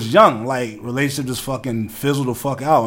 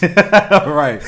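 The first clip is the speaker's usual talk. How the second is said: laughing; 4 semitones higher, 4 dB louder than usual. Real, not acted. Laughing at friend's joke.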